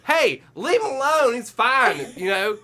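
high, loud mimicry of a daughter; imitated daughter. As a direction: imitate a daughter brushing off worry. Daughter's retort voiced in the story.

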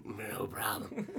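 slow soft smoker voice; raspy smoker. Retold or imitated. Front desk lady replying, slow raspy smoker voice. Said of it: "exactly that voice".

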